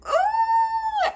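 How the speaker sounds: very high, sustained whoop; long high-pitched crow of pride; squealed "ooh" of self-congratulation; triumphant "ooh". Real, not acted. Crowing about managing it in Japanese.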